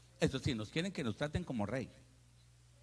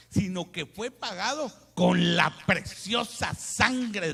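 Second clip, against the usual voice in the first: from raised reading to very loud, slow climax; building scripture proclamation; 6 semitones higher, 10 dB louder, slower than usual. Acted. Preacher reading about Christ's blood, building to a loud slow climax. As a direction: swell louder to a thundering close.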